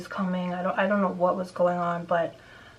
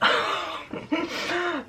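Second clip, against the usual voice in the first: high, breathy burst of laughter; real laugh; 10 semitones higher than usual. Real, not acted. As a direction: laugh out at the creepiness. Laughs after imitating creepy neighbor.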